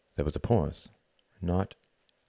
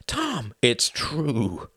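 pleading; 6 semitones higher, much slower than usual. Acted. Hart pleads, slow and high.

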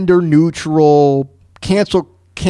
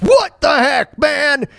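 high-pitched retold meltdown; screaming rant; 8 semitones higher than usual. Host re-enacting his pandemic meltdown video, screaming rant. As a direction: replay an outraged scream, pitched way up. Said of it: "I'm screaming. I'm like".

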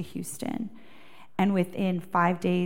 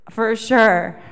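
punchy, much louder repeat; loud for sure; 9 dB louder than usual. Shown as a demonstration. Preacher calling out to the crowd for sure. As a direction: call it out with conviction.

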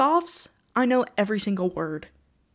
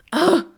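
frustrated ugh; 8 semitones higher, 8 dB louder than usual. Real, not acted. Frustrated groan.

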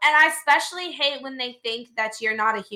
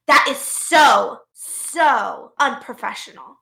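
drawn-out, emphatic "so, so" in mock disapproval; indignant customer; 4 dB louder, much slower than usual. Customer scolds clerk for eating at the desk, indignant imitation. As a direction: imitate a scandalized customer, dragging each "so".

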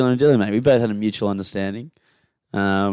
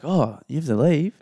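mock disappointed; 5 semitones higher than usual. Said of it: "he was genuinely, like, upset".

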